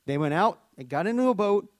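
usual speaking voice

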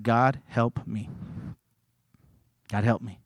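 soft, slow, low desperate prayer; quiet plea. Retold or imitated. Preacher retells praying over the man, soft and slow. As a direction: whisper a plea to God. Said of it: "So I said".

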